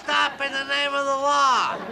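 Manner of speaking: high pompous police command; hammed-up lawman order; mock lawman. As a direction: play the big sheriff. Captain playing lawman.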